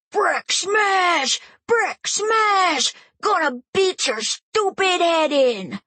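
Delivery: put-on high, loud shouted little-boy roar; a seven-year-old playing the monster; roaring child brute voice; kid's roaring brute shout. Kid roaring wrestler catchphrase.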